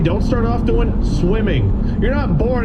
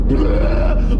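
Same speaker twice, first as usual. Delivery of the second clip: vomit noise. Pretend. Cat vomiting imitated.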